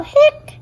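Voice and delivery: one high loud hiccup; squeaky hiccup. Acted. Girl hiccuping while friends think, high squeak.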